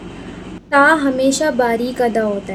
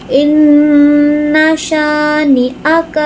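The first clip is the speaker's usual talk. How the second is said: slow melodic tajweed recitation, ghunna held on اِنَّا; Quran recitation; 2 semitones higher, much slower than usual. Shown as a demonstration. Teacher recites the verse for students, slow and melodic. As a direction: chant the verse slowly with long nasal hold. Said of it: "Hamza نون".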